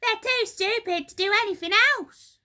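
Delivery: high, loud, fast sneering hag voice; nasty Missus Twit. A narrator's reading. Missus Twit sneering at the monkeys, high fast hag voice. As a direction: cackle it out like a spiteful old wife. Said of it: "said Missus Twit".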